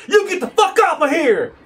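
high, loud furious yell; angry shout. Acted. Sammy confronts the Salt Peterman, high and shouting. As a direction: shout him down in a rage. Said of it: "Sammy is, like, getting upset".

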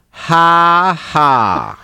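loud, drawn-out "ha... ha", high then dropping; heckler's mock laugh. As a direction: imitate a heckler's slow, loud fake laugh. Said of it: "this guy in the crowd going".

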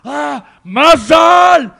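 drawn-out high scream; retold shout. Storyteller imitating screams behind him, long high yell. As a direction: stretch it out at full volume, high.

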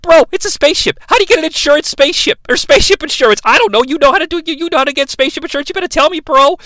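high, rapid-fire bro rambling; quick mock bro impression; Mark Wahlberg. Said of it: "Mark Wahlberg's like".

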